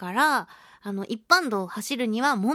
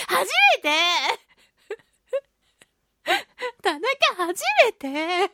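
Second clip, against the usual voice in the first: giddy excitement; 8 semitones higher, 4 dB louder, much slower than usual. Real, not acted. Giddy over first love letter.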